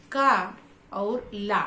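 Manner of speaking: का and ला pulled apart, each slow and separate; syllables split slowly. Shown as a demonstration. Teacher splitting the word for students, slow and separated. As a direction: say का, pause, then ला, slowly. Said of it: "इस शब्द में दो अक्षर".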